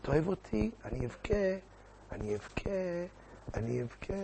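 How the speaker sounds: very soft, fading mock whimper; mock tearful pleading. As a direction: let the threat of tears shrink away. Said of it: "הוא איכשהו יסתדר עם הבכי". (בכיינות מוצגת, חלשה ודועכת)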